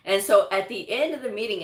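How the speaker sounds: ordinary talk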